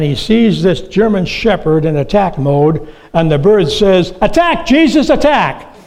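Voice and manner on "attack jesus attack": very high, loud squawked order with a laugh in it; parrot command. Acted. Parrot ordering the German shepherd to attack, high loud squawk. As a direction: shriek the order like a parrot siccing its dog.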